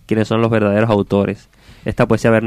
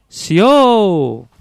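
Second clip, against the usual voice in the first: high, drawn-out distant call; echo; 9 semitones higher, 4 dB louder than usual. Acted. Voice answering from the other side, echoing call. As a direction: stretch it out like an echo, falling at the end. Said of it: "una voz le dijo".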